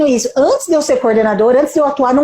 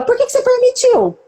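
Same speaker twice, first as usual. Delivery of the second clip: a much higher, challenging 'why did you allow it'; retold pointed question; 10 semitones higher than usual. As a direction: throw the question back at her. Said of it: "qual foi a minha resposta pra ela?". (pergunta desafiadora, bem mais aguda)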